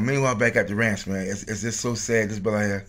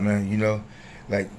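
ordinary talk

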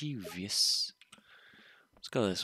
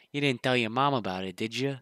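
usual speaking voice

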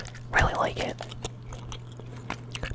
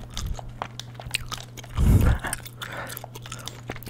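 whispered laugh. Real, not acted. Laughs at people fighting.

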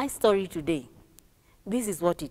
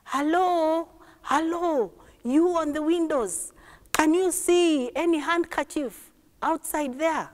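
higher-pitched calling voice; boy calling from the train; 6 semitones higher than usual. Acted. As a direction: call across the carriage to the window seats. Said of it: "the boy was asking from inside".

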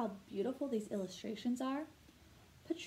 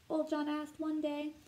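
ordinary delivery, nothing marked

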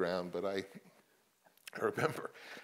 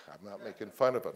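ordinary delivery

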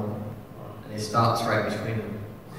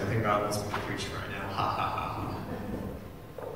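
nervous laugh; 9 dB quieter, slower than usual. Retold or imitated. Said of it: "I was like".

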